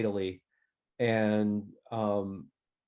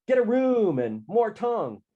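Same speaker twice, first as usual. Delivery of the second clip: high, loud jeering calls; shouted heckling; 11 semitones higher, 5 dB louder than usual. A narrator's reading. Freshman monkeys heckle the kissing couple, shouting. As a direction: call out like hallway hecklers. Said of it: "started shouting stuff like".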